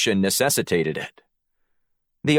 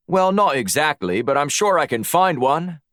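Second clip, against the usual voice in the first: higher, hedging Aussie voice; Australian accent; 6 semitones higher than usual. A narrator's reading. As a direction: bluff along in an Australian accent. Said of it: "summoned his best Australian accent".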